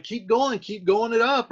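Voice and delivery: high, loud egging-on; urging on. Acted. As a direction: spur a friend to continue. Paraphrases Romeo urging his rival on.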